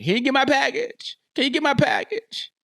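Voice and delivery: high-pitched pleading wife voice; high, rising nagging ask; imitated wife. Voicing wife asking for her package.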